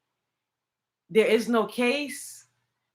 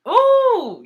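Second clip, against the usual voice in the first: surprised ooh; 12 semitones higher, 7 dB louder than usual. Real, not acted. Sudden exclamation.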